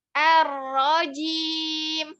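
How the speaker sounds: Ar-rajim slow, not through the nose; slow tajwid recitation. Shown as a demonstration. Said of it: "suaranya turunkan lagi".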